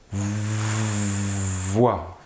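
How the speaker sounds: "voix" with a long buzzing v before "oi"; first sound drawn out. Shown as a demonstration. Teacher isolating the first sound, "voix" with stretched v. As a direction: hold the v of "voix" as a sustained hum. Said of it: "on entend".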